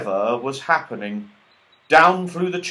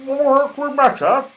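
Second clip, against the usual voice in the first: mouth full; 14 semitones higher, 7 dB louder than usual. A narrator's reading. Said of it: "she asked with her mouth full".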